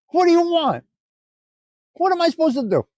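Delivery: high exasperated what do you want; exasperated squeak. Real, not acted. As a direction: pitch shoots way up in mock exasperation. Exasperated reply to chat.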